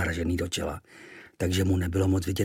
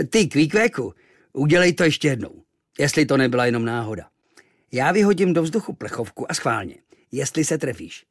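louder, higher doubting seaman; challenging sailor; 4 semitones higher, 5 dB louder than usual. Acted. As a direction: dare him to shoot again.

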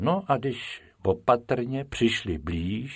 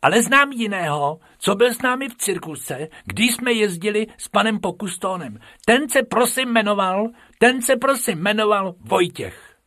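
high, eager pupil voice; squeaky little rabbit recounting; Bobek character voice; 11 semitones higher, 5 dB louder than usual. A narrator's reading. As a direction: prattle on high and keen to teacher. Bobek eagerly answers teacher in class.

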